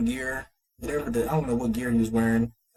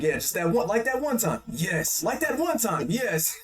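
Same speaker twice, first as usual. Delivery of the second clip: bluffing Ainz; 8 semitones higher than usual. Retold or imitated.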